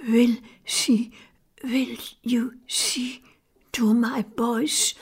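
slow, faint whisper on little breath. A narrator's reading. Dying priest entrusting his boys to the sisters, whispering. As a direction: whisper it haltingly, with pauses for breath. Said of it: "en fluisterde dan".

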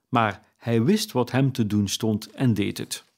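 usual speaking voice

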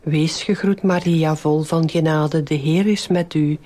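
even, measured rosary lead; devout, rote Hail Mary cadence; prayer recitation. Real, not acted. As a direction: recite a well-known prayer in steady phrases.

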